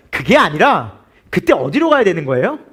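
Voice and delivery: ordinary talk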